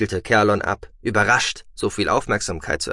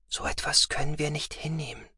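quiet; 6 semitones higher, 8 dB quieter than usual. Acted. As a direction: say it softly to him. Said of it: "fügte sie leise hinzu".

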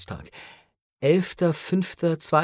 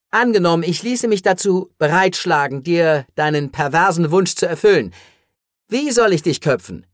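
higher, louder incredulous questioning; animated hero's raised voice; exasperated hero; 4 semitones higher, 6 dB louder than usual. Acted. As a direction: pose the hypothetical forcefully. Hero's voice in narrated story.

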